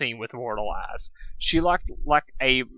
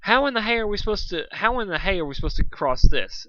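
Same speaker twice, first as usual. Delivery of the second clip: exclaiming; 7 semitones higher, 4 dB louder than usual. Acted. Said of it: "exclaimed Dusk".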